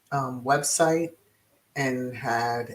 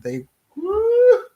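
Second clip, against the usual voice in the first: a sudden high, loud woo; delighted shriek; high whoop; 7 dB louder than usual. Real, not acted. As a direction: squeal at the juicy news. Exclaims at the gossip.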